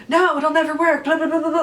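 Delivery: high, hammed-up fretting; mock anxiety-ridden frustration. Actor mimicking her own train of emotion, anxious and frustrated. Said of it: "like, this anxiety-ridden, like, frustration".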